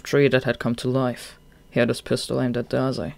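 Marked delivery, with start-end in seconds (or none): none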